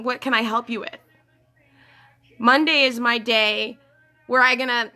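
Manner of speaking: usual speaking voice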